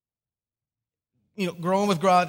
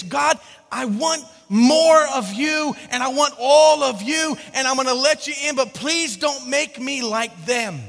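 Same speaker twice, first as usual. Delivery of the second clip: loud, higher-pitched prayer, leaning hard on key words; fervent plea; 5 semitones higher, 8 dB louder than usual. Retold or imitated. Teen praying to God in church, loud fervent plea. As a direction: plead emphatically, stretching words for comic earnestness. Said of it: "I would pray this".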